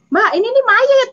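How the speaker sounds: high, loud panicked cry; alarmed driver. Retold or imitated. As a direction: shout it in fright as the driver. Driver repeats his alarm.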